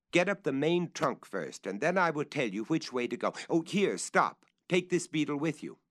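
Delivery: calling instructions. Acted. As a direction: urge him up and hand over the beetle.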